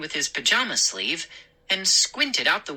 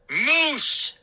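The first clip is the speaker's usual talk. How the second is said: raised, drawn-out call of the name; angry shout; 11 semitones higher than usual. Acted. McCall calling his son home, angry shout. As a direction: summon him crossly. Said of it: "shouted in a deep, angry voice".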